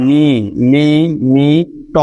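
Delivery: নি, লি, মি, ট as isolated sounds with pauses; wrongly split syllables. Shown as a demonstration. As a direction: cut the final ট off from মি. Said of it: "এভাবে কিন্তু কখনোই বলা যাচ্ছে না".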